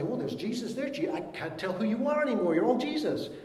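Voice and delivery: high, baffled voice; mock confused friend. Friend failing to recognise a changed believer, high and baffled. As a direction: play a friend who can't tell you from Jesus. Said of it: "It's not like suddenly".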